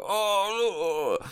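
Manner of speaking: high, dismayed little moan; mock dawning horror; horrified. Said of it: "the face of the second person go".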